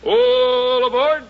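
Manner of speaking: slow, drawn-out, high train conductor call; conductor's call. Acted. Announcer closes the railroad show, drawn-out conductor call.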